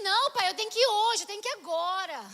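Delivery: loud, pressing retold protest; urgent insistence. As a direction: insist it must be right now. Insisting to father in retold scene.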